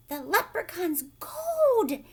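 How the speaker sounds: high, loud, slow with a laugh; gasp of delight. Acted. Old Pat finding the golden harp, loud laughing gasp. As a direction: gasp it out in joyful surprise. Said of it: "gasped old Pat".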